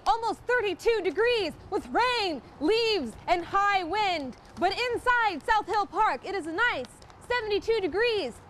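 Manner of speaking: high, loud, gushing weathercaster; hammed-up TV weather voice; over-the-top weather girl. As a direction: sell the forecast like a game show host.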